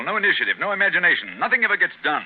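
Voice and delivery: rising irritated outburst; frustrated detective ranting; exasperated rant. Acted. Holmes rants at idleness.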